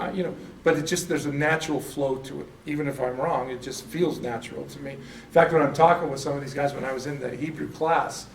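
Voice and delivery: normal talking voice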